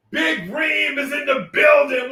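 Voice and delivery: high, slow, stretched announcer call; drawn-out sing-song announcement. Real, not acted. Streamer repeating a viewer's welcome, slow sing-song call. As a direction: stretch it out like an arena intro.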